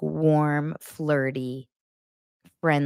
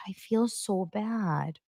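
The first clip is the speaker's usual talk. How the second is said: slow, quiet and small; a hint of sheepish guilt; mock pitiful Leah; 8 dB quieter, much slower than usual. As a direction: whimper it slowly. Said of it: "Leah's like".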